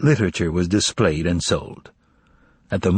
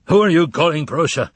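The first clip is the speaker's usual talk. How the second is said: haughty; 6 semitones higher than usual. A narrator's reading. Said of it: "the suit asked haughtily".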